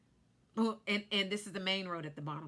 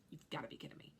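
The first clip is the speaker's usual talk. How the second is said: breathless whisper, disbelief; stunned whisper; 4 semitones lower, 12 dB quieter, much faster than usual. Retold or imitated. Stuck on an icy mountain, whispering in disbelief. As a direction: whisper it like you can't believe your luck.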